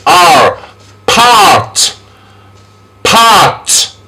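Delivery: long AR in Part; R-sound demonstration. Shown as a demonstration. Teacher shows vowel and consonant together, Part drawn out. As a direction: sound out R, then stretch Part. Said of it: "to give the R".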